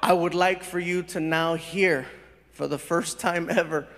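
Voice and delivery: low, measured announcer delivery; formal stage introduction. Real, not acted. Comedian introduces the other side of a story, solemnly. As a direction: build suspense with deliberate pauses.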